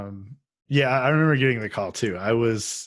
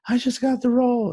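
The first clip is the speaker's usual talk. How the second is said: high-pitched, gushing announcement; excited news-breaking; 13 semitones higher, 4 dB louder than usual. Retold or imitated. Telling a coworker about the casting, excited and high. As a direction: retell it as a giddy squeal of news.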